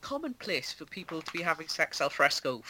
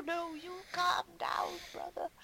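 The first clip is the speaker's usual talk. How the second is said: mock forced calm; 10 semitones higher, 8 dB quieter than usual. Imitating Brian Blessed faking calm at his brother. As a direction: swallow the rage back down. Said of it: "he keeps telling, um, Leonardo".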